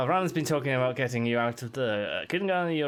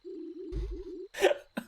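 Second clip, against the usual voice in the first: breathy granny cackle; 4 dB quieter than usual. Acted.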